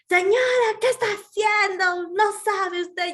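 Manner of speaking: high, loud, scolding doctor voice; imitating a yell. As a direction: bark at the patient like an angry doctor. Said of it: "Les grita, Miss, les grita". (imitando a la doctora gritando a la paciente, voz alta y chillona)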